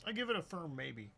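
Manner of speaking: flat deadpan. Acted. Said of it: "Why was that sultry?".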